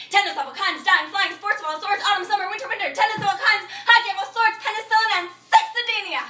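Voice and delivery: high, loud runaway list; manic listing. Acted. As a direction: rattle off the items in a mounting frenzy. Acted rant, runaway list of sports.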